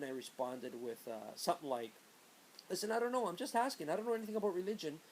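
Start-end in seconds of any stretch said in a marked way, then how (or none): 2.7-5.0 scared excuse. Retold or imitated. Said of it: "I got scared".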